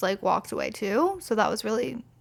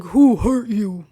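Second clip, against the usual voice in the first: a lightly voiced tough guy; mock macho guy; 5 dB louder than usual. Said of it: "then he's like".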